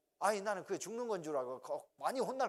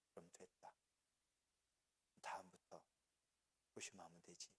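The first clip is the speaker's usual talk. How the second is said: hushed, gentle fatherly forgiveness; much softer, calm father; 9 semitones lower, 16 dB quieter than usual. Retold or imitated. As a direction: let him off tenderly, almost under breath.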